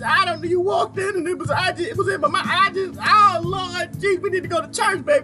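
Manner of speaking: very high, mock-panicked wife voice; hammed-up pleading in a squeaky high pitch; wife making excuses. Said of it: "they're trying to convince their husband".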